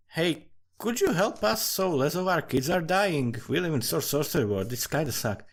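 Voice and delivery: a light pretend plea to the village god; pleading villager. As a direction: beg the god like a tired villager. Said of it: "You ask your god".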